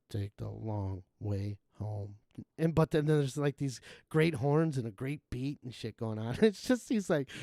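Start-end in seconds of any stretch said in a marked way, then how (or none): 0.1-2.2 flat, slow monotone, lower and quieter. Retold or imitated. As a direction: drone it with no tune. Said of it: "most monotone voice".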